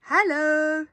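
high, loud cheerful hello; a friendly shout of hello; Norman's hello. A narrator's reading. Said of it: "said Norman".

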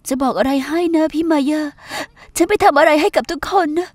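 usual speaking voice

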